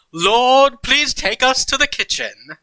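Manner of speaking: high, loud cry to the heavens; bellowed prayer. A narrator's reading. Dumbledore praying to the Lord for transport, bellowing. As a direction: call it out grandly, pitch way up. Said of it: "bellowed in a voice even louder".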